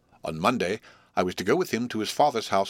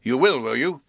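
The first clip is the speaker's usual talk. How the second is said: louder, higher blustering outburst; 6 semitones higher, 7 dB louder than usual. Acted. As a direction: burst out in indignation. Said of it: "my guardian blustered out".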